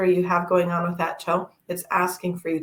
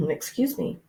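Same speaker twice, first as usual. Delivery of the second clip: quiet apology; 7 semitones higher, 6 dB quieter than usual. Real, not acted.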